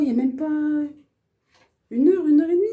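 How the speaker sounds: plus aigu et plus fort, outrée; outrée, n'en revient pas; indignation surprise. Real, not acted. Indignée, la brosse déjà sale. (outrée, la voix monte)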